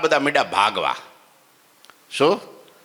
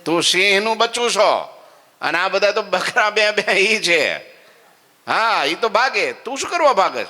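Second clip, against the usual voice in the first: lion scolding the cub; 9 semitones higher than usual. Acted.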